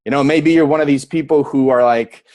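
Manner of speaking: normal talking voice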